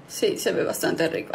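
hushed, unvoiced whisper. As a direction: whisper it, eyeing the food. Tempted whisper.

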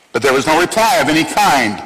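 ordinary delivery

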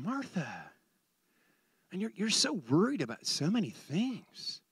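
soft, tender correction; hushed, caring address; gentle reproof. Retold or imitated. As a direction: say it softly, to calm a worrier. Jesus gently answering worried Martha.

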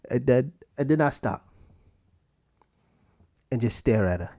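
ordinary delivery

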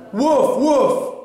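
two high imitated woofs; dog's barking. A narrator's reading. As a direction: bark twice like a storybook dog. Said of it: "barked Ebb".

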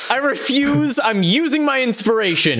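high, insistent refusal; indignant outburst. Pretend. Player refuses bad roll and uses inspiration, indignant.

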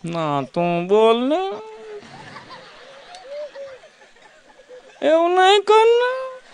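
put-on high, slow coy girl voice, giggling; a high, drawn-out lovesick girl on the phone; coy girlfriend. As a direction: coo slowly like a shy fiancee. Girl voiced cooing to fiance.

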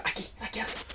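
a quick breathy aside whispered at the camera. Real, not acted. Gamer points viewers to the link, whispering.

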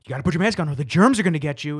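fast, mock-spooky kid song warning; mock scary warning. Songs scaring kids about masks, quick mocking warning. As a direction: rattle it off like a scary jingle. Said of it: "into, like".

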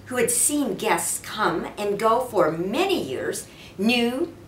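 plain reading voice

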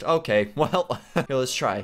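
ordinary talk

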